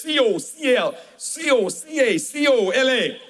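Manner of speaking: slow, drawn letter-by-letter chant of C O L A; mock tongues spelling C O C A C O L A; spelled out slowly. Shown as a demonstration. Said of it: "say this".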